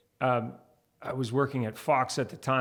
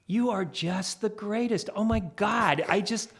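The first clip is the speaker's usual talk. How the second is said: high, slow, syrupy praise; gushing producer; 7 semitones higher, slower than usual. Retold or imitated. Producer flattering another reporter, high and gushing. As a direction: lay the flattery on thick. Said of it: "the same producer talking to another reporter".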